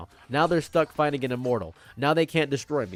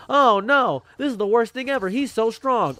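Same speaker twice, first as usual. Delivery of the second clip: high squeaky scared whine, pitched way up; mocking panicked victim; 9 semitones higher, 5 dB louder than usual. Mocking his beaten enemies in a high panicked squeak. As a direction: whimper like a terrified loser.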